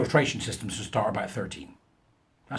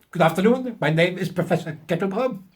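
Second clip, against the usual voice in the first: higher, louder hammed-up German accent; mock German professor; 7 semitones higher, 4 dB louder than usual. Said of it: "of German extraction".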